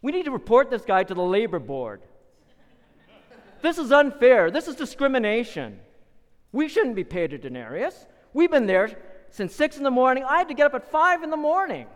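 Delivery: high, loud indignant protest; loud complaining. Acted. Early workers complaining to each other about unfair pay. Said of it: "you start complaining".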